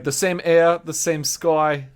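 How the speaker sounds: teasing imitation of a faked New York accent; mock New York accent. Host mimicking guest's attempt, mock New York accent. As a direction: exaggerate the New York vowels. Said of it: "What do people from New York sound like?".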